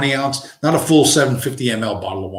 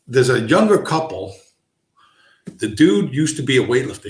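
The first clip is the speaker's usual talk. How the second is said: normal talking voice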